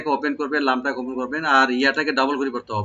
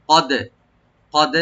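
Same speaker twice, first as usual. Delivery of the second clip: normal talking voice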